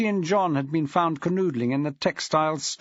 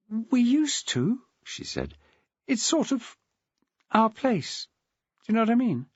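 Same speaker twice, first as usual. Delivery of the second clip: put-on a girl remembering her special place; Louise, wistful; 9 semitones higher than usual. A narrator's reading. Louise telling her old teacher about the gardens, wistful.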